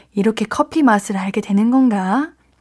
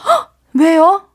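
surprised; 5 semitones higher, 4 dB louder, slower than usual. Real, not acted. DJ reacts to a listener letter.

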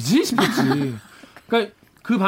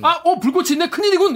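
high, loud hammed-up panic; mock criminal voice; 8 semitones higher, 4 dB louder than usual. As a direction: play a startled crook, overacted. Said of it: "이러고, 이러고". (과장된 범죄자 흉내)

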